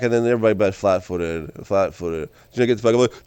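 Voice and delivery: normal talking voice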